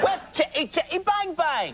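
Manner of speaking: hammed-up Cockney, pitched high; bad British accent. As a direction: overdo a terrible British accent. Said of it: "Do a British accent".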